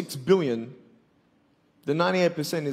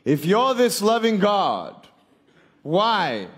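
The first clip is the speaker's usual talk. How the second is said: a louder, higher, slower demand; angry challenge; 7 semitones higher, 7 dB louder, slower than usual. Acted. Speaker challenges God about suffering, loud and slow. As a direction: raise your voice at God.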